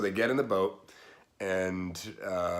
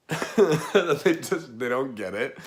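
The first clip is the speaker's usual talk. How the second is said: laughing; 4 semitones higher, 6 dB louder, slower than usual. Real, not acted. Laughing at annoyed Jesus.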